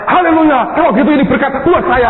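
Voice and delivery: high, loud exclamation of triumph; loud, high-pitched rejoicing; jubilant cry. Retold or imitated. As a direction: shout it out as a sudden win. Friend's acted cry of joy.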